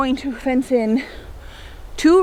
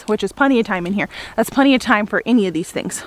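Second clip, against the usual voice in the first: usual speaking voice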